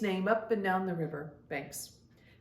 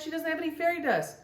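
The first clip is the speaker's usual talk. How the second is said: much higher, fast and worried; alarmed; 9 semitones higher, faster than usual. Acted. Prilla fears for Gabby who cannot fly, alarmed. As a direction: worry rising fast.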